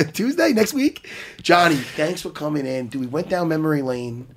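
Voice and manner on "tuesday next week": high, amused rapid-fire questions; teasing laughing questions. Real, not acted. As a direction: pester him playfully, laughing.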